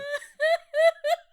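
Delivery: laughing till it sounds like crying; high crying laugh. Real, not acted. Friend cracking up at the stall story, high squealing laugh.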